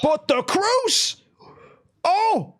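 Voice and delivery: very high, drawn-out cry of amazement; high-pitched shock at a sudden turn; excited play-by-play. Real, not acted. Caster reacts to a sudden turn in the match, excited. Live match commentary at climax.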